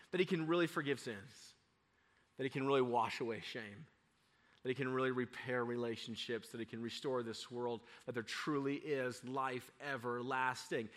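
low, soft, earnest sermon tone; subdued preacher listing hopes; hushed preaching. Real, not acted. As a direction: bring it down to a quiet, reverent promise. Preaching, drops to hushed register.

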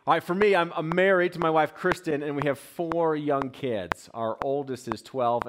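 normal talking voice

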